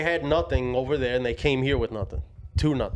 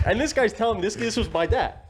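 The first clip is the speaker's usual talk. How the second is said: laughing; 8 semitones higher than usual. Real, not acted. Laughing retelling the surprise.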